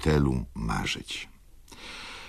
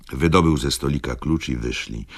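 plain reading voice

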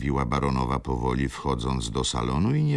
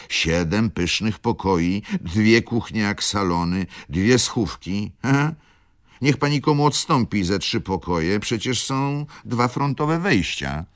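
steward exclaiming; 7 semitones higher, 5 dB louder than usual. Acted. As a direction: list the rooms with emphasis. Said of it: "zawołał rządca".

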